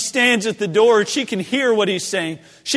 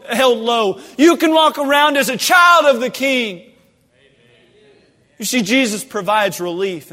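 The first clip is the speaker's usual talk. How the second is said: louder, higher revival preaching building to a shout; preaching at full force; 6 semitones higher, 9 dB louder than usual. Acted. Preacher to congregation, building to a fervent shout. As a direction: lift the room with rising fervor.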